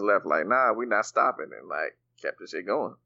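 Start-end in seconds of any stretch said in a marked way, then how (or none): none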